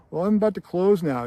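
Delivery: nervously trying to end the chat; uneasy old man, lightly voiced; uncomfortable clerk. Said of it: "The guy's really uncomfortable".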